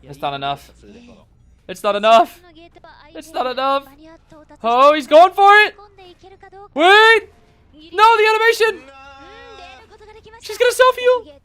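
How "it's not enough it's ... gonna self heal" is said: loud, high, rising excitement; excited yelling. Real, not acted. Streamer yelling at a tense tournament moment, loud and high. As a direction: yell at the screen as it unfolds.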